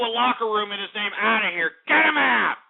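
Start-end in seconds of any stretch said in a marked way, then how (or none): 1.9-2.6 sudden loud bark, pitch jumps up; bouncer-style shout at a troll; get him out shout. Real, not acted. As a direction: throw him out with a yell. Host ejecting a troll guest.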